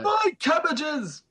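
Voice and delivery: high, drawn-out anguished cry; cabbage merchant impersonation. Shown as a demonstration. Having a go after the sick friend's attempt, high mock wail. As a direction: cry out in theatrical despair, high and stretched.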